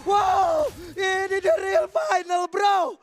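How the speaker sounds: loud hyped caster shout; esports caster. Real, not acted. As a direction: hype the real final. Hyped final call.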